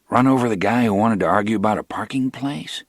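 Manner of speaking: usual speaking voice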